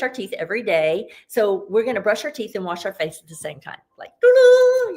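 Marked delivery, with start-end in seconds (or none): 4.0-5.0 mock toothbrush sound. Shown as a demonstration. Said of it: "you could do it like that".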